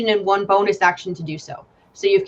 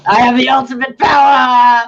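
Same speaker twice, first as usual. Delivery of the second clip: shouted villain gloat, louder; hammy evil overlord boast; mad scientist; 3 semitones higher, 7 dB louder than usual. Pretend. GM gloats controlling sheet.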